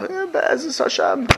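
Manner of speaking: high, slow shrugging "Eh, Be'ez Hashem."; naive son-in-law shrugging. Retold or imitated. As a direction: drawl it out high and unbothered. Son-in-law answer in joke.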